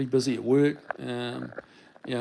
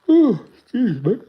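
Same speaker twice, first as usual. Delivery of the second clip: stretched high through a yawn; apology escaping mid-yawn; 10 semitones higher, 9 dB louder than usual. Real, not acted. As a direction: excuse me said through a real yawn.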